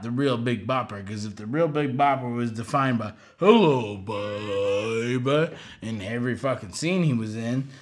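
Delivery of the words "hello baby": put-on punchy louder hello, drawn-out baby; hammed-up crooner line; mock catchphrase. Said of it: "loves his own phrase".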